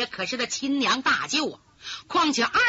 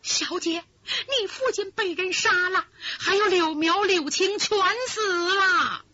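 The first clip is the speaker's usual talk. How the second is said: high, tearful report of the deaths; weeping servant breaking bad news; crying maid; 6 semitones higher than usual. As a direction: sob out the terrible news. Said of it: "低低阴声哭".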